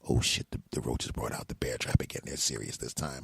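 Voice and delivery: low, hushed mutter to himself; muttering landlord. Retold or imitated. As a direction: grumble it under your breath like a caught landlord. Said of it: "I overheard him say".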